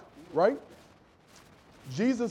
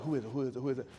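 wary door question; 6 semitones lower, 9 dB quieter, much faster than usual. Retold or imitated. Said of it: "I'll be on the Ring camera like".

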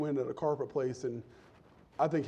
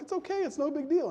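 very high, softer, carefree voice; cheery apprentice; 11 semitones higher, 3 dB quieter than usual. Retold or imitated. Happy apprentice soothing angry Joe, high and breezy. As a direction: brush it off airily.

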